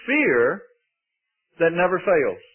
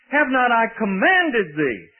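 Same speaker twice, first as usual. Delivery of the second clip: slow, higher reading with COMMANDED punched; emphasis on COMMANDED; 5 semitones higher, slower than usual. Real, not acted. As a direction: hammer "commanded" in a measured scripture reading. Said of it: "That's my emphasis".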